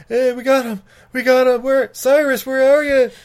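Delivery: high, loud panicked calling out; frantic shouting. Retold or imitated. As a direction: yell for a missing teammate. Retelling Matthew Lillard calling out.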